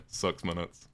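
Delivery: slow New Zealand SIX MINUTES, like 'sucks munuts'; mimicked New Zealand accent. Brothers about fourteen parroting the sailor, mock New Zealand accent. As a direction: parrot the New Zealand vowels as a teenage joke. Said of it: "it sounds like sucks my nuts".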